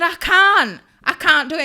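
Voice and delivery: loud, high-pitched protest; retold desperate refusal; panicked refusal. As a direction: plead out of it in mock panic.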